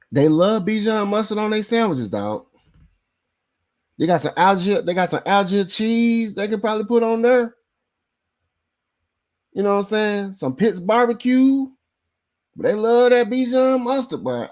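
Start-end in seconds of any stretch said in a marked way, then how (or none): 0.1-2.1 high mocking voice, teasing the rival fans; mocking sing-song. Mocking rival fans' food taste. 4.0-14.2 high mocking voice, teasing the rival fans; mocking sing-song. Keeps mocking rival fans' tastes.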